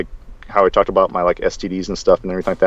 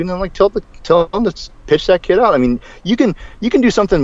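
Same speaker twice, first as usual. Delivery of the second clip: worked-up rant; 9 semitones higher than usual. Real, not acted. Heated rant about coach.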